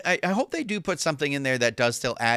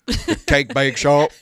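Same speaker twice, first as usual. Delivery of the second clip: Forrest Gump; 3 semitones higher, 9 dB louder, slower than usual. Acted. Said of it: "it was very Forrest Gump".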